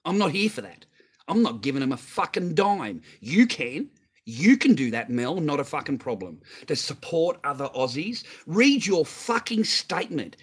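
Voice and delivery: real outrage, shouting him down; angry rant. Real, not acted. Refusing to pay for Aussies, angrily shouting at Mel. Ranting at Mel over taxes.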